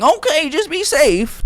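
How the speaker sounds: high, slow motherly voice; lightly mock a permissive mom. Mom letting son take the boat, high and slow.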